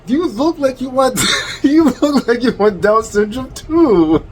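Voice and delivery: high-pitched jeer breaking into laughter; mocking retort. Real, not acted. Reactor turns the insult back on the other man, mocking. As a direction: throw it back at them, high and amused.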